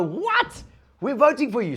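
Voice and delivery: very high, loud astonished what; excited disbelief. Retold or imitated. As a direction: squeal like a starstruck fan. Landlord learns tenant is on TV.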